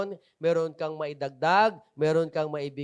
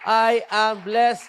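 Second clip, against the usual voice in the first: very slow, louder, drawn out; slow proclamation; 3 semitones higher, 8 dB louder, much slower than usual. Shown as a demonstration. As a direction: proclaim it slowly, word by word. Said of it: "sa bibig mo".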